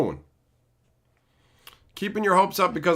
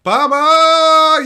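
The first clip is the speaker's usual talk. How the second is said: loud greeting call; 11 semitones higher, 11 dB louder than usual. Real, not acted. Greeting arriving viewer.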